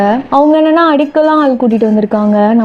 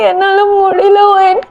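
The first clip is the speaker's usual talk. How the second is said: high, slow, drawn-out wail; tearful high-pitched lament; acted sobbing; 6 semitones higher than usual. A narrator's reading. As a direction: sob the words out helplessly. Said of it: "தேம்பி தேம்பி அழுதுட்டே இருக்கா".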